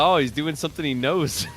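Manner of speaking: amused retelling. Retold or imitated. Retells own amused thought, laughing.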